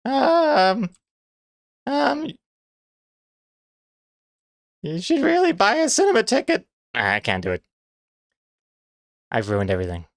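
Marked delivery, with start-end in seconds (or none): 0.0-6.7 high squeaky falsetto woman; Simpsons-style squeaky lady. Pretend. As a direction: squeak it out, on the spot. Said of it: "[name]'s lady voice is the squeaky voice".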